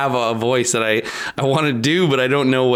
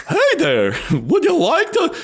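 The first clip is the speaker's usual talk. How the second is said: high squeaky character voice, rising; Mad Hatter voice; slower than usual. Acted. Trying the Mad Hatter voice again, high and squeaky. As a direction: pitch way up into a silly host. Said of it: "I guess I got a shit voice".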